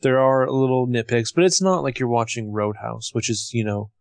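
normal talking voice

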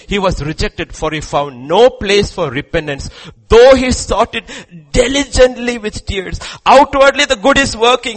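loud, high scripture declamation; full-force preaching. Acted. Preacher declaiming the warning about Esau's tears, full-force preaching. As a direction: drive each phrase home to the crowd.

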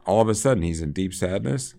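ordinary delivery

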